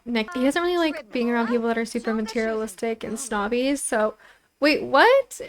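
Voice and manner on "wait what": surprised. Real, not acted. Game pops up a surprise.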